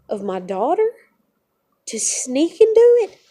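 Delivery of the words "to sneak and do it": much higher and louder, cracking with anger; anguished furious cry; real outrage. Real, not acted. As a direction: explode at the betrayal. Furious about losing custody.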